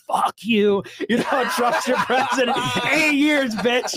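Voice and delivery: retold victory jeer; mock trolling taunt. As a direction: taunt the crowd, half laughing. Trolling campus with Trump flag.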